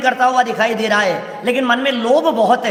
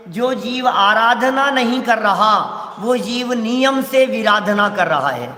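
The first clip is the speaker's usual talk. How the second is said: slow, deliberate quotation; slow solemn quote; slower than usual. Shown as a demonstration. Preacher quotes the elder pandit, slowly and gravely. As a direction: weigh each word of the elder's saying. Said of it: "बड़े पंडित जी का एक वाक्य है".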